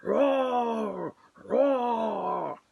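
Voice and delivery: loud mock lion roar, long and falling. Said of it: "He was really roaring loud".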